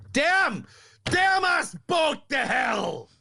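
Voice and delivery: shouted curse. Acted. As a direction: roar the curse to the heavens. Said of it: "Demon voice?".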